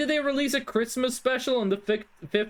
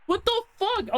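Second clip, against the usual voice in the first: shocked disbelief; 10 semitones higher than usual. Real, not acted. Shocked by the game's answer.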